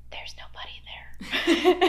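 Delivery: hushed, high, breath-heavy confession; spooked half-whisper. Acted. Telling the boss about unexplained crying, spooked half-whisper. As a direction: confide it in a strained whisper. Reporting an eerie discovery to boss.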